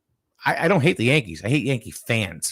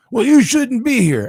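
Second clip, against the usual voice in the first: higher, louder mocking taunt; drunk fan threat; 12 semitones higher, 7 dB louder than usual. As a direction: jab it like a belligerent drunk.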